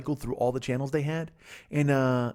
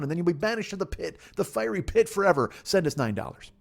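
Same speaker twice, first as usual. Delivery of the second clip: higher-pitched, run-on mock preacher; televangelist; 5 semitones higher than usual. Televangelist warning viewers to send money, mock preaching. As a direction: parody a TV preacher pleading for money. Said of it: "They're just like".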